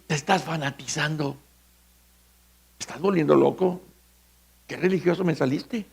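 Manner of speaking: mocking critic. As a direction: mutter it like a mocking friend. Mocking critics voiced in sermon.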